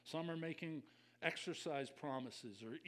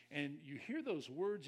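ordinary talk, nothing marked

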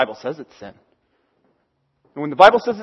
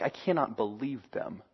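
real-life disapproval; 10 semitones lower, 16 dB quieter than usual. Pretend. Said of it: "usually it sounds more like".

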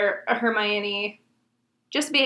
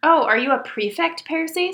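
higher-pitched feigned surprise; mock great surprise; 6 semitones higher, 3 dB louder than usual. A narrator's reading. Reader voices a twin teasing Percy, mock surprise. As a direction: act lightly astonished. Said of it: "with an air of great surprise".

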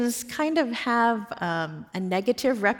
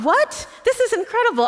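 much higher, louder burst of disbelief; retold amazement; 5 dB louder than usual. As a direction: exclaim it high, reliving the astonishment. Retells her amazed reaction.

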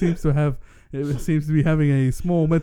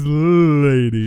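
loud husky sultry come-on; 6 dB louder than usual. Pretend. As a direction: purr it like a perfume ad. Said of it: "my sultry, sultry voice".

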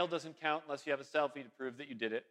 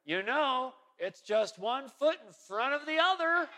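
put-on quoting old husband; 7 semitones higher than usual. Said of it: "her husband over there went".

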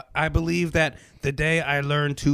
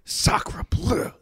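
French chef gasp; 4 semitones higher than usual. Acted.

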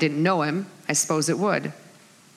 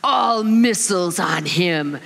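commanding villain; slower than usual. Retold or imitated. Kylo Ren ordering his forces to attack Luke Skywalker, mock command. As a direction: order an all-out attack, stretching the words. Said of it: "He's like".

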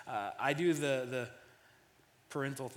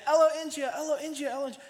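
put-on high-pitched toddler demand, starting loud; squeaky-high kid repeating herself insistently; imitating toddler daughter; 13 semitones higher, 6 dB louder, faster than usual. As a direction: mimic a small child chanting her request. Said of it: "She kept saying".

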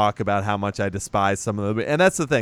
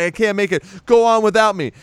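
higher and louder, mock heroic; hammed-up sacrifice; 9 semitones higher, 5 dB louder, faster than usual. Stein telling the kids to leave him, mock heroic. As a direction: play the martyr grandly. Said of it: "he's like".

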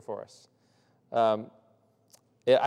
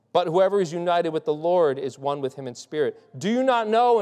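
higher and louder, pressing each "Do you not know"; emphatic reading; 4 semitones higher, 4 dB louder than usual. Shown as a demonstration. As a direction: proclaim the verses with rising force. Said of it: "a great way to start an emphatic statement".

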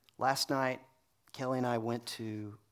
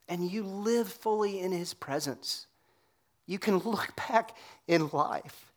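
louder, higher, driving the point home; preaching at full force; 5 semitones higher, 5 dB louder than usual. Acted. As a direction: rise up and preach it to the congregation.

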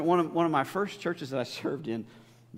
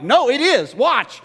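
higher and louder, firm comeback; insistent retort; 8 semitones higher, 10 dB louder, slower than usual. Retold or imitated. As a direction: push back with confidence. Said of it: "I'm like".

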